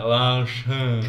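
imitating an open-mouthed pupil; slack-jawed kid. Pupil calls his teacher, slack-jawed imitation. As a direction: let the jaw hang and drag the words out. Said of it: "he's funny because he's slack-jawed".

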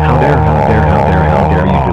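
screaming. Acted. Angry father screams at the son who deleted data. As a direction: bellow it at the culprit, full fury. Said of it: "Why are you screaming at me".

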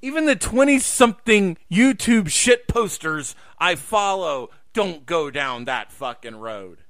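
ordinary delivery

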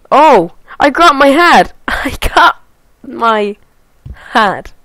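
high, loud delighted exclaim; laughing with glee; excited. Real, not acted. Delighted at grabbed hat.

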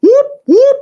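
very high, loud whoops; piercing mock siren; imitated police siren. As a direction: sing out two siren whoops.

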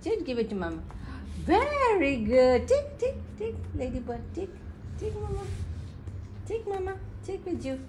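high, sing-song coaxing. Real, not acted. Mother coaxing baby to hand it over, baby talk.

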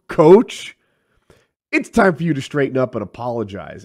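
stern dressing-down. Retold or imitated. Coach confronting a disrespectful freshman, loud stern dressing-down. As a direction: lay down the law, punching each question.

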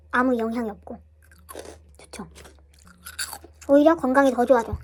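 ordinary delivery

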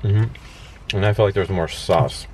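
chewing while talking; eating pizza in car; mouth full of pizza. Real, not acted. Seen 3: Both men eat pizza; left bit just before, speaker unsure.

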